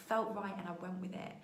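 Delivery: ordinary delivery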